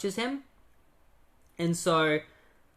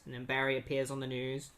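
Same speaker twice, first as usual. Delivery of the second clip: normal talking voice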